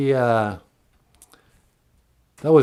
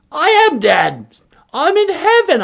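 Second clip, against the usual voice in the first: put-on very high, loud posh British old lady; squeaky mock Queen Mum; Queen of England; 11 dB louder than usual. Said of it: "Queen Elizabeth?".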